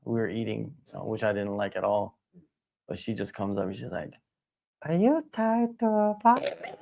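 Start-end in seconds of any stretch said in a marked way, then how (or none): 4.8-6.8 high, drawn-out starstruck fan impression; young female fan. Teller imitates a small fan approaching Tai, high and slow. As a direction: put on a tiny, much higher voice, slowly.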